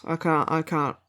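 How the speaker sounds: ordinary talk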